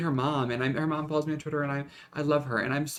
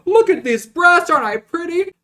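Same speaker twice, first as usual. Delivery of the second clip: high, loud, vain mock-pretty voice; campy diva voice; 12 dB louder than usual. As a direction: preen like a vain diva, squealing. Campy self-parody bit.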